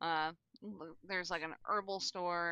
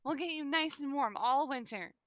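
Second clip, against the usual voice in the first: grandma voice; 7 semitones higher than usual. Acted. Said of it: "She goes".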